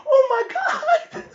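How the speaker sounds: very high, drawn-out imitation of panic; terrified child. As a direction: wail it like a scared boy. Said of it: "I'm so scared".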